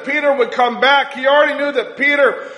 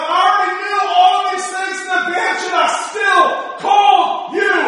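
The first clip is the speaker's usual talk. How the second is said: preaching at full force; 5 semitones higher than usual. Acted. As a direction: preach it like a revival, building. Preacher voicing Jesus, building.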